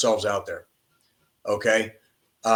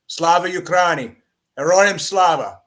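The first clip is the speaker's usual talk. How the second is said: rallying cry; 4 semitones higher, 5 dB louder than usual. Real, not acted. Host sends off his listeners with a rousing shout. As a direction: shout it like a rally chant to the troops.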